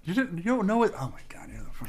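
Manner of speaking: shocked voiceless whisper. Real, not acted. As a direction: hiss it in disbelief.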